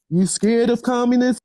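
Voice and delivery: lightly voiced policeman needling the sisters; cop voice. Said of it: "that's her cop voice".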